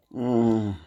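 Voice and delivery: ordinary talk, nothing marked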